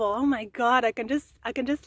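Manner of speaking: ordinary delivery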